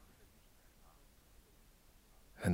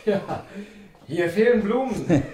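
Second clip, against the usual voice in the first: much higher, laughing through the words; amused quip, laughing and louder; 11 semitones higher, 3 dB louder than usual. Acted. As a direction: laugh as you say it. Amused remark in the flat.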